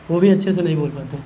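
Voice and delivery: ordinary talk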